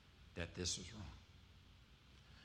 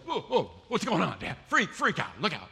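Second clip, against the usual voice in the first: put-on high, loud alarmed kids' yelps; frightened children shouting from the van; kids panicking; 8 semitones higher, 13 dB louder than usual. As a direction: yell like startled kids in the back seat. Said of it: "And my kids are going".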